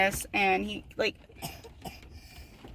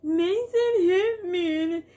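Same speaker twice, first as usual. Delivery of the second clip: much higher, louder, slow sobbing whine; crying tattle; 10 semitones higher, 5 dB louder, slower than usual. Retold or imitated. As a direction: blubber it like a kid running to mom. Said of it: "she'll be like".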